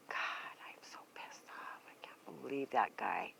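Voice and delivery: slow, seething whisper to herself; angry whisper. Real, not acted. Fuming alone on camera, a slow furious whisper. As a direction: hiss it under your breath, slow. Venting about the neighbor, muttering.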